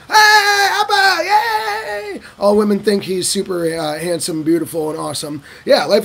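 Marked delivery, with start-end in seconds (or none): 0.1-2.2 very high, drawn-out squeal; swooning fan. Retold or imitated. Women greeting handsome Appa, very high squeal. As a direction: gush like a smitten fan.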